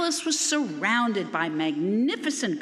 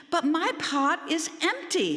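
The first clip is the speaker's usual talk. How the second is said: stammering; 7 semitones higher than usual. A narrator's reading. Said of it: "he stammered".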